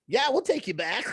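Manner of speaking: high, bright mock eagerness; lightly voiced eager boss. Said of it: "Tracy was like".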